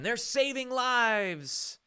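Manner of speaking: slow mocking Irish leprechaun lilt; drawn-out hammy leprechaun voice. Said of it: "My leprechaun voice cracks you up".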